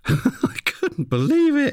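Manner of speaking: high, slow, laughing through the words; laughing disbelief. Real, not acted. Teller recalling the absurd script request, laughing disbelief. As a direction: let the laugh carry the line, high and drawn out.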